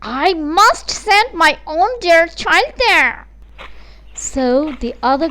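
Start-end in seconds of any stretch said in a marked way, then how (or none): 0.0-3.3 slow, higher, louder, self-satisfied; mother doting on her favourite; scheming mother. Acted. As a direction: savour each word like a plan. Mother plans for favourite daughter.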